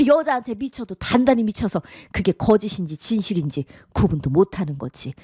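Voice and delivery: normal talking voice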